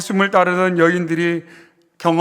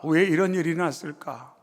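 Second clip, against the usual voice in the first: quiet, falling, inward repetition; softer broken echo of the cry; 3 semitones lower, 6 dB quieter than usual. Acted. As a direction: let the question sink to a murmur. Same question echoed softly.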